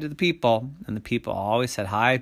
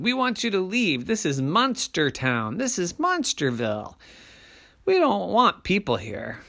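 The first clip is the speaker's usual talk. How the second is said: higher-pitched protesting monster voice; insistent monsters; 8 semitones higher than usual. A narrator's reading. Monsters telling the big person to go, insistent. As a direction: state the demand with raised pitch.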